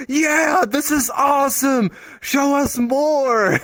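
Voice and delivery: high cheering crowd voice; excited cheer. Retold or imitated. Players cheering the boss show, high excited.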